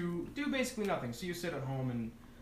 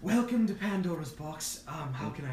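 trying a new voice. Acted. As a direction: test out an unfamiliar voice for the greeting. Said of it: "I could try to sound like something".

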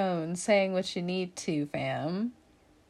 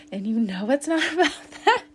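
louder, higher, laughing through it; 6 semitones higher, 5 dB louder than usual. Real, not acted. Reader laughing as she repeats to Libra. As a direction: insist while cracking up.

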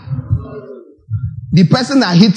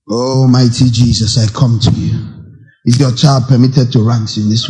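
acted low-pitched plea to heaven; mock solemn prayer; 6 semitones lower than usual. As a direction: intone it like an earnest prayer. Acts out turning to prayer.

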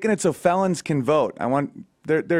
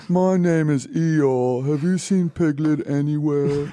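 put-on slow, doleful donkey with a shaky English accent; Eeyore; slower than usual. Co-host turns the buzzkill voice into Eeyore, doleful drone. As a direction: drag it out, glum and put-on. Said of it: "Eeyore has an English accent".

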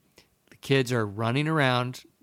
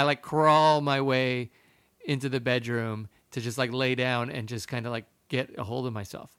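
ordinary delivery, nothing marked

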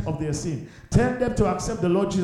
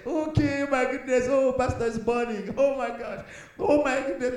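higher-pitched mock amazement, lightly voiced; mocking onlooker; 7 semitones higher than usual. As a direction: play a lazy viewer gawking at the pastor's fire. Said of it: "relax and say".

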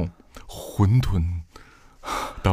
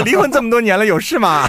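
mock mother voice; 12 semitones higher, 5 dB louder than usual.

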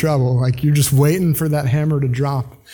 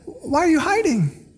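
gentle God; 11 semitones higher, 3 dB quieter than usual. Retold or imitated. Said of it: "so much grace and compassion in God's voice".

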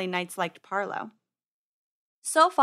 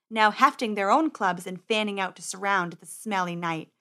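usual speaking voice

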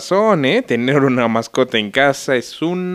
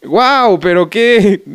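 fake amazement; 7 semitones higher, 4 dB louder, much slower than usual. Mock amazement at vegetables.

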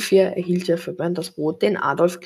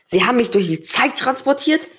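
raised, indignant outcry; a young man rounding on his tormentor, loud and higher; angry outburst; 5 semitones higher, 6 dB louder than usual. A narrator's reading. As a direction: Tom yelling at Zorak in disbelief, raised and angry. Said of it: "rief Tom wütend".

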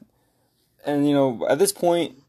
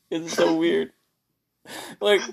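ordinary delivery

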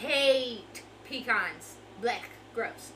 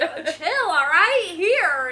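loud, higher retold dad voice, half laughing; imitating exasperated dad; 8 semitones higher, 13 dB louder, much slower than usual. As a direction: lightly act out dad giving in. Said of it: "he was like".

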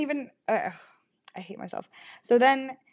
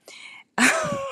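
sudden high-pitched giggle burst; high squealing laugh; 4 dB louder than usual. Real, not acted. Burst of laughter after Simba.

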